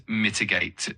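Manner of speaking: "mitigate" hit on MI-, the rest light; stress on first syllable. Shown as a demonstration. Tutor modelling the stress for a learner, slow and deliberate. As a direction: say "mitigate" leaning hard on the first syllable, slowly. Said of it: "emphasis on the first syllable".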